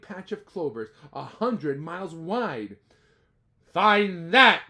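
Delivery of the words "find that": loud sneering taunt; sneered. Acted. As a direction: sneer it down at him. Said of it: "sneered the bird".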